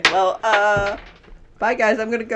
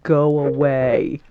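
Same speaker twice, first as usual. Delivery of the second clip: lower, slow, loud go away; menacing order; 6 semitones lower, 5 dB louder, much slower than usual. Acted. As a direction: drop low and slow to scare it off. Sunshine scaring off dog.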